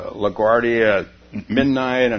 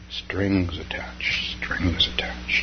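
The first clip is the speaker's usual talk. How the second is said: slow, drawn-out whisper; 3 semitones lower, 6 dB quieter, much slower than usual. Acted. Speaker voicing a wary thought, slow whisper. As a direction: let it hang like a warning.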